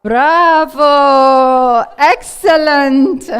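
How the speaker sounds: loud, drawn-out praise; delighted cheering. Real, not acted. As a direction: cheer it out loudly, stretching the words. Cheering on a participant's answer.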